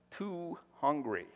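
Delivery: ordinary talk, nothing marked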